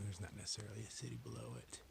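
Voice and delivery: ordinary talk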